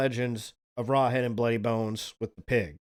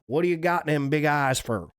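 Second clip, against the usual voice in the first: a light Alabama twang, a bit louder; 3 semitones higher, 4 dB louder than usual. Shown as a demonstration. Frightened hunter asks the monster, in an Alabama twang. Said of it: "We have to give him the Alabama twang".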